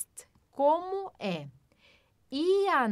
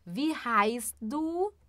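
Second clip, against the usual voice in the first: slow, deliberate German question; teacher demonstrating German slowly; slow model phrase; much slower than usual. Shown as a demonstration. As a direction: say it slowly for beginners. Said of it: "de uma forma informal, que é assim".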